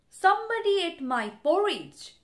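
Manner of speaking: lightly voiced shouting papa bear; mildly shouted gruffness. A narrator's reading. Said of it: "shouted Papa Bear in his big gruff voice".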